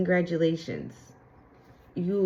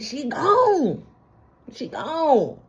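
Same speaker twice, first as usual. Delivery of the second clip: sassy declaration; 6 semitones higher, much slower than usual. Real, not acted. Declaring the old self gone.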